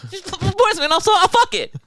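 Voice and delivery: laughing high squeal. Real, not acted. Laughing crude joke with brother.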